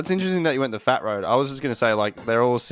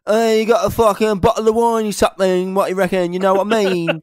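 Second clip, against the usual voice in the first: loud, higher-pitched boozy guest; 7 semitones higher, 6 dB louder than usual. Acted. Singer asking boyfriend's mum for wine, loud impression. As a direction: put on a loud, brash party-guest voice. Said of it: "brings fucking [name] back".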